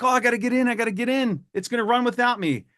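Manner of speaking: mock a trader desperate to get in; panicky FOMO buyer. Imitating anxious traders chasing a rally, high and frantic. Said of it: "people will see things running like".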